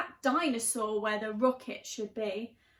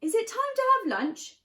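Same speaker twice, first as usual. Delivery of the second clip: higher, eager dinosaur voice; hungry dino; 9 semitones higher, 4 dB louder than usual. Acted. Hungry dinosaur asking Danny, eager. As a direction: ask like a hungry pet.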